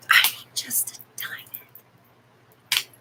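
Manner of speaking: whispered aside. Real, not acted. Streamer confiding to chat in a whisper. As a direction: breathe it as a secret. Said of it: "whispered aside".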